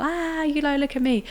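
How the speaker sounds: high-pitched, held-back imitation of a drunken holler; mock drunken shout. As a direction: imitate a drunk calling for attention without real volume. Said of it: "I think I was shouting, like, you know, when you're drunk".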